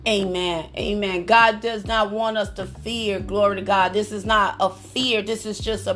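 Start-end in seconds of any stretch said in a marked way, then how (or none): none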